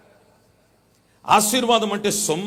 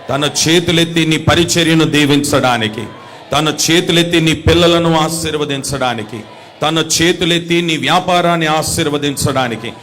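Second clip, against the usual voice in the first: louder and louder, rising in pitch to a climax; full-force preaching; 14 dB louder than usual. Acted. Pastor preaching to the congregation, building to a shouted climax.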